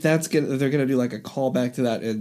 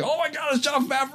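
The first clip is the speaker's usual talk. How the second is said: a sudden excited cry, way up high; excited recognition; 13 semitones higher than usual. Retold or imitated. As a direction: gasp it out, thrilled to recognise him. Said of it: "I was like".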